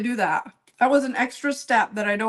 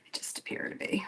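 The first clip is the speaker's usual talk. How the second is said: whispering; 13 dB quieter than usual. Real, not acted. Whispered aside.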